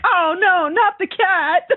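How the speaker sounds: high, slow mock wail over the cat; hammed-up whimper, drawn out and high. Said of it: "I'm like".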